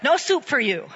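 a hint of the curt Seinfeld soup man; lightly voiced stern soup seller; soup Nazi. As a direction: snap the refusal like a deli tyrant. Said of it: "We are not the soup Nazi".

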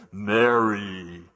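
booming megaphone-style "Mary", mock startled reply. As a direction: call the name through a megaphone. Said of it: "megaphone voice".

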